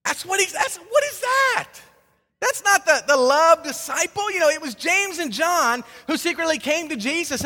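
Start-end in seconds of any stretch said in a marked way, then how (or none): 0.0-4.3 high, loud cry of disbelief; a sudden high yelp of disbelief; incredulous outburst. Real, not acted. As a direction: squeak out "what is that?" in shock. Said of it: "exasperated outburst".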